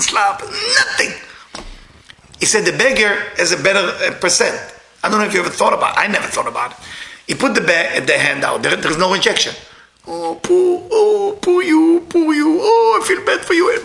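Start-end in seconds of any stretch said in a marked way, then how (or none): 0.0-1.1 mock shout. Door to door, slapped with rejection. 10.1-13.8 sugary mock sympathy for the poor beggar; mock pity voice. Mimicking people pitying a beggar.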